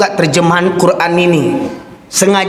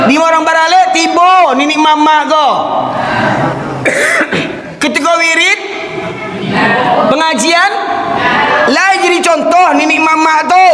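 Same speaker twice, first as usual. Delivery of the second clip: higher, laughing mockery of the elders; mocking the clan elders, scornfully higher; mocking scorn; 7 semitones higher than usual. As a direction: jeer at them with a scornful laugh. Preacher mocks elders at feasts.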